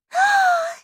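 big, high, loud gasp; sharp theatrical intake of breath; excited gasp. Acted. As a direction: gasp in delighted surprise. Veruca sees the squirrels.

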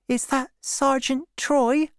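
put-on high, timid girl's voice; tremulous high question from a girl; shaking voice. A narrator's reading. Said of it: "asked the girl in a shaking voice".

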